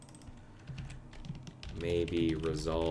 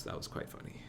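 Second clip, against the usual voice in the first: whispered aside; 12 dB quieter, faster than usual. Real, not acted. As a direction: breathe it as an amused aside. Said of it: "unvoiced aside".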